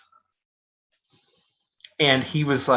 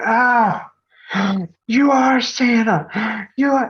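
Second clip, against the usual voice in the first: put-on much higher, slow, breathless child's gasp; a stunned kid realizing it's really Santa; awed little kid; 11 semitones higher, 7 dB louder, much slower than usual. Kid after tugging beard.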